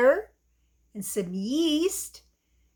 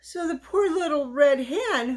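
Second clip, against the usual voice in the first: ordinary delivery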